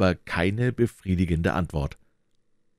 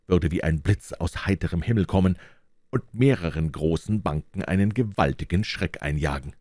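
plain reading voice

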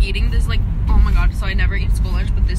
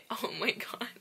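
breathy laugh into a whispered oh my god; 3 semitones higher, 10 dB quieter, slower than usual. Real, not acted. Vlogger laughing to the camera about the game, whispered. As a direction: giggle it out under your breath. Laughing at her own story.

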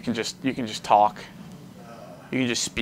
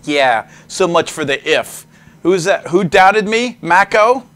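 louder and higher, cocky; loud gloating taunt; 6 semitones higher, 9 dB louder than usual. Real, not acted. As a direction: rub it in triumphantly. Taunting a doubter in chat.

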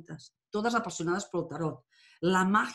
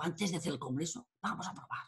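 much softer, almost under the breath; a hushed half-thought, much softer; softer aside; 4 semitones lower, 9 dB quieter than usual. Retold or imitated. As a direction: say it quietly to yourself, trying the idea. Retold plan, said to brother.

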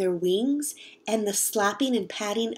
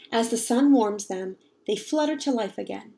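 usual speaking voice

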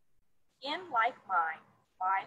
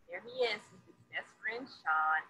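usual speaking voice

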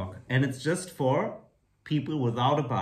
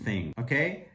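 ordinary talk

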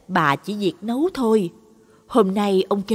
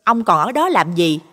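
sharp-tongued wife, louder and fast at the end; shrill nagging; 5 semitones higher, 5 dB louder, faster than usual. A narrator's reading. Wife scolding husband to hurry and butcher the dog, sharp nagging. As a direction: nag him, getting faster and louder. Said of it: "giọng vợ ông Năm chua ngoa".

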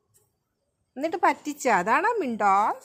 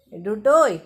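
calling out; 10 dB louder, slower than usual. Real, not acted. Mother calling child's name.

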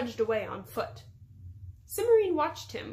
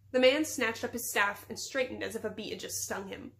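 ordinary delivery

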